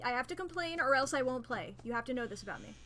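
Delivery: ordinary talk, nothing marked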